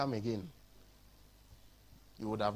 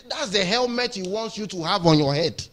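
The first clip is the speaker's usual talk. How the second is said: ordinary delivery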